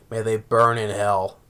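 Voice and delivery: low, slow, soft sinister voice; ominous curse. Retold or imitated. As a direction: intone it like a creepy cult. Retold siblings' curse.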